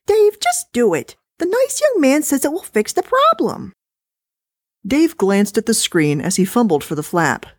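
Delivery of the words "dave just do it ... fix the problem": much higher, fussy wife; dismissive, high and chatty. Acted. Wife complaining to husband about the recording, high-pitched.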